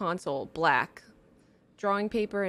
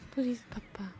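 breathy whispered begging; whispered plea; 4 semitones higher, 4 dB quieter than usual. Pretend.

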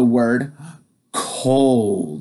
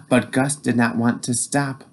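ordinary delivery

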